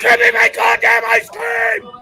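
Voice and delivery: high, loud furious demand; voicing the kid who wants his ice cream, furious shout; angry kid shout. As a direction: yell it like a kid at his limit.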